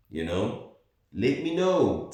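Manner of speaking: normal talking voice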